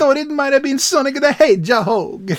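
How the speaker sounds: high, squeaky henchman; high character voice. Retold or imitated. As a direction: pitch it way up for the goon. Reading the comic character's line.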